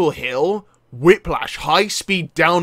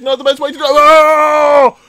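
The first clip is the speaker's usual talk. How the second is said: higher, rising into a loud scream; panicked repeat; 6 semitones higher, 9 dB louder than usual. Real, not acted. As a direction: panic mounting until you scream. Repeats as crash comes.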